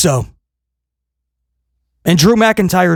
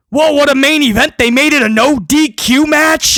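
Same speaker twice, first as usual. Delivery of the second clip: mock hype; 7 semitones higher than usual. Mock hype vs flat repeat.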